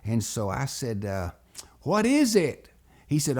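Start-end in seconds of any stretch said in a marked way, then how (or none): 1.8-2.7 put-on higher, louder boy's question; eager question. Boy asks Buddy about his surprise, eagerly. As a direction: ask it with keen, excited curiosity.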